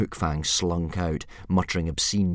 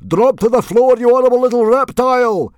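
high, very loud roar of command; furious yell; 13 dB louder than usual. A narrator's reading. Chief orders dragon off his beard, full yell. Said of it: "yelled Stoic".

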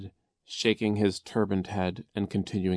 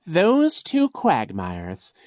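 high, slow, loud villain voice; scheming Coach Genghis; 8 semitones higher, 9 dB louder, slower than usual. A narrator's reading. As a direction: feign puzzlement with drawn-out villainy. Narrator plays Coach Genghis.

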